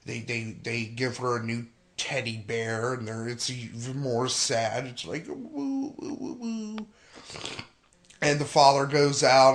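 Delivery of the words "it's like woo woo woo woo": mock sad wail. Mocking the sad music.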